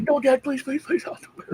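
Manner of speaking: put-on high, pleading kid's whine; mock pleading kid. Imitating his son begging dad not to erase his games. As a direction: beg like a kid about to lose his saves.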